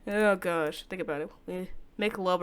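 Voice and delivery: ordinary talk, nothing marked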